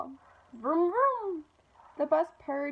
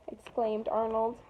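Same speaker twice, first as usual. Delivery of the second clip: plain reading voice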